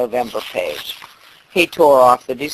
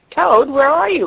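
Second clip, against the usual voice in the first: high call into a dark room; 11 semitones higher than usual. A narrator's reading. Frog calling into Toad's dark house. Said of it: "called Frog".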